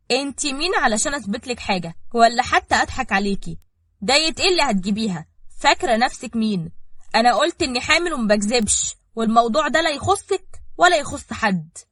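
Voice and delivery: affronted, forceful comeback; indignant rebuff. A narrator's reading. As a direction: put the accuser firmly in their place. Heroine rebuffs accusation in quarrel.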